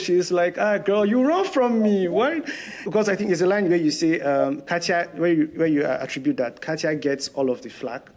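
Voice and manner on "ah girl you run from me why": put-on Russian accent. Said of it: "the typical Russian accent I see on T V".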